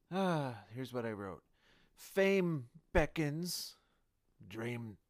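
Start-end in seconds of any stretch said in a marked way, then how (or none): 1.9-3.8 dramatic. Pretend. Reading his old writing aloud.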